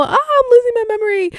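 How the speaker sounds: high, hammed-up whimpering complaint; mock whine. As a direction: whine it like a melodramatic crybaby. Mock despair at forgetting.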